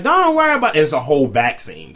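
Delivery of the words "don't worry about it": high, loud mock reassurance; acted tattoo artist's brush-off. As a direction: wave it off in a raised, pitched-up voice. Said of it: "So it's just like".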